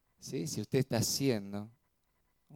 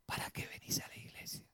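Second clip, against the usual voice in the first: whispered, breathy-free hiss of doubt into the ear; ¿Para qué venís a la iglesia? whispered low and sly; enemy's whisper; 15 dB quieter, faster than usual. Retold or imitated. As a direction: whisper it like a tempter at your ear. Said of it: "nos susurra a veces en el oído".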